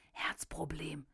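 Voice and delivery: sly devil's whisper. A narrator's reading. Devil on his shoulder whispering a threat, whispered. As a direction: breathe it like a tempter on the shoulder. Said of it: "flüsterte er".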